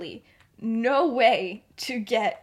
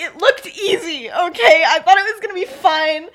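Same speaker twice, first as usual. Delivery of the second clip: high, loud mock indignation; hammed-up defensive outburst breaking into laughter; defensive protest; 7 semitones higher, 9 dB louder than usual. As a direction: act out a flustered self-defence, pitched up. Acted defensive reply from struggling skater.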